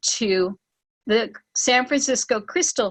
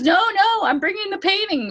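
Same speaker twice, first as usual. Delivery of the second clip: much higher, bright insistence; bubbling over with news; excited friend on the phone; eager; 9 semitones higher than usual. Retold or imitated. Elizabeth eagerly changing her mind.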